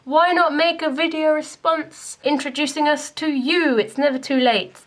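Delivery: ordinary delivery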